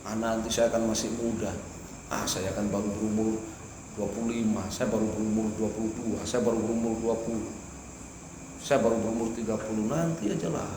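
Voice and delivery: lightly voiced careless youth brushing off advice; mock procrastinator. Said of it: "Godaan taswif".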